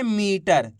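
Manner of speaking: "मीटर" with the ई stretched long; drawn-out vowel. Shown as a demonstration. Teacher repeats the right reading, "मीटर" drawn out. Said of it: "खींच के, बड़ी ई की मात्रा को खींच के".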